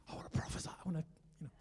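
hushed, breathy whisper of a prayer. Retold or imitated. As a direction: murmur it under your breath. Praying under breath in store.

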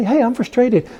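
acted self-talk, announcing it as a problem to tackle; matter-of-fact resolve. Preacher models the better response to frustration, lightly acted resolve. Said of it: "Or rather, you can say".

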